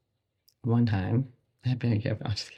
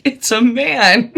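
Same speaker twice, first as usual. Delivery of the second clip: slow, louder, higher mock announcement of the news; deadpan doctor announcement; 9 semitones higher, 9 dB louder, much slower than usual. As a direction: announce it slowly like a stunned doctor. Doctor announcing big baby.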